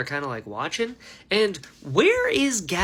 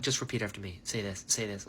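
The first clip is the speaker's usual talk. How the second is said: hushed coaching voice; 10 semitones lower, 11 dB quieter, faster than usual. Retold or imitated. Voice in Szeth's head coaching.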